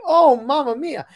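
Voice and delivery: high, loud Italian exclamation; strong Italian accent. Retold or imitated. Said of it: "Strong Italian accent".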